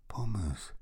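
hushed, breathy name; stunned sorrow. A narrator's reading. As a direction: breathe the lost friend's name. Grieving for captured best friend. (betroffen gehaucht)

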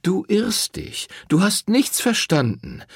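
a lightly voiced admonishing priest. A narrator's reading. Priest lectures Rosetta. (höhere, belehrende Priesterstimme)

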